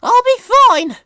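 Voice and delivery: high and loud, proud insistence; stubborn old lorry; Elizabeth. A narrator's reading. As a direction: brush off the worry boldly. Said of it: "replied Elizabeth".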